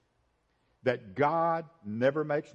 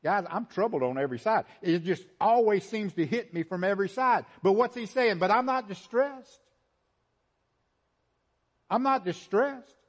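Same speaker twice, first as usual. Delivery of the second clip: lightly acted apostle in a higher preaching voice; Paul's defiant testimony; 7 semitones higher, 3 dB louder than usual. Preacher voicing Paul's troubles to congregation, raised and insistent. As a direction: insist on it, pitch climbing. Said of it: "Paul is saying".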